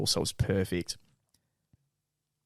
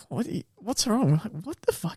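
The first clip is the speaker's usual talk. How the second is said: alarmed; 10 semitones higher, 4 dB quieter than usual. Retold or imitated. Friends alarmed.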